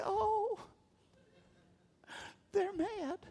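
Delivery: high, mocking falsetto question; mock God whining in a high voice. Preacher mocking God in a high squeaky voice. Preacher mocks God's imagined reaction.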